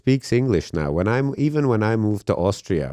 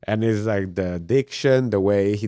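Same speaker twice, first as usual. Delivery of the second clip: usual speaking voice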